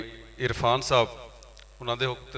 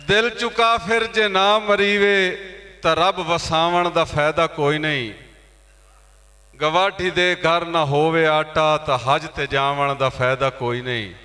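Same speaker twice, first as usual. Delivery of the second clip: high, ringing openings that descend at each close; impassioned poetry recitation; 6 semitones higher, 6 dB louder than usual. Acted. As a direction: declaim the verse to the whole hall. Recites verse at mushaira. (ਜੋਸ਼ ਨਾਲ ਉੱਚੀ ਸੁਰ ਵਿੱਚ ਸ਼ਾਇਰੀ)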